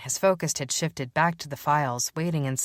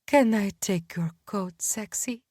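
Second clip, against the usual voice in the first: purring; 3 semitones higher than usual. Acted. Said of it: "the coat rack purred".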